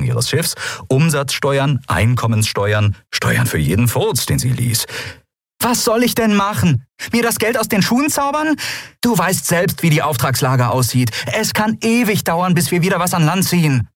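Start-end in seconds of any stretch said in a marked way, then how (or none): 5.6-13.9 high-pitched, indignant protest; exasperated quarrelling. A narrator's reading. Captain defending herself about money, exasperated. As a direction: protest hotly in a much higher voice. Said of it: "sich anschrien".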